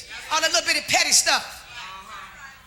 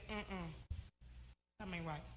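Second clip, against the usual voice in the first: low inner doubt; 11 semitones lower, 26 dB quieter than usual. Retold or imitated.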